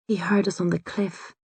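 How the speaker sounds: soft, hushed confiding; a hushed aside to the wounded druid; whispered. A narrator's reading. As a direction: lean in and tell him quietly. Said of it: "I whisper".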